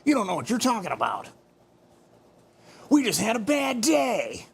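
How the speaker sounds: lightly voiced anime girl, fast then high; a hint of a cute anime girl. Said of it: "my best impression of an anime".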